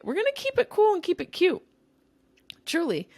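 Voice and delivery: lilting cutesy sing-song. Real, not acted. Playful sing-song sign-off.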